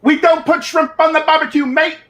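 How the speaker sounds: high-pitched, hammed-up Australian accent. Shown as a demonstration. Asked by co-host for best Australian accent, hammed-up high attempt. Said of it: "give me your best Australian accent".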